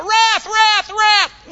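high, loud triple cry; mock crying out. Preacher acting what angels never said, high mock shout. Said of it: "They cried out".

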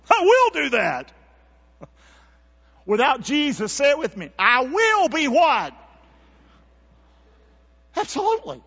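preacher firing up the congregation; rising preacher fervor. Real, not acted. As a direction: lift the room with it. Preacher rising to full force.

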